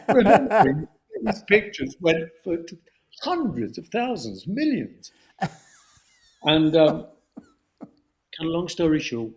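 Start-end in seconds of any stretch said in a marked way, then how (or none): none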